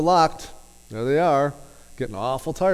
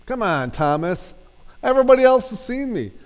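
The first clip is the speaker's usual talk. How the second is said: much higher and louder, fast exasperated scolding; mock belittling; 10 semitones higher, 7 dB louder than usual. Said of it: "could have belittled".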